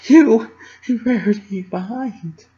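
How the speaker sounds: high, choked words through tears; crying. A narrator's reading. Dash confessing to Pinkie while crying. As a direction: push the words out between sobs. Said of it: "a wet sniffle stifled my speech".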